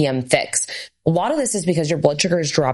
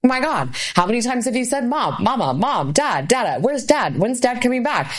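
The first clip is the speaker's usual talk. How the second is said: put-on higher pleading kid voice, over and over; mild whiny kids calling Mom and Dad; kids nagging voice; 7 semitones higher than usual. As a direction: whine like kids calling for a parent. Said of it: "And you're like".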